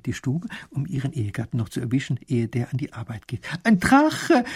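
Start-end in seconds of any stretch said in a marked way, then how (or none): 3.6-4.4 high, loud call across the room; wife calling to her husband from afar, high and loud. Acted. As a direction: shout it out from a distance. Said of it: "ruft sie ihm schon von Weitem zu".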